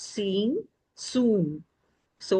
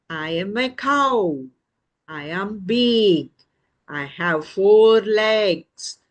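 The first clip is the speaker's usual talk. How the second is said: slow, emphatic storybook cow; friendly cow; 3 semitones higher than usual. Acted. Cow tells the chick it is not its mother, slow and emphatic. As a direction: speak slowly as a big cow.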